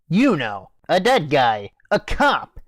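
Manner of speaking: ordinary talk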